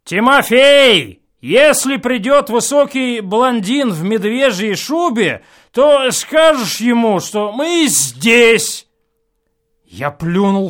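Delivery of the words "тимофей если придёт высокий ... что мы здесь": calling out. A narrator's reading. Reveller shouts instructions to the servant, loud. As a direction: shout orders down the hall.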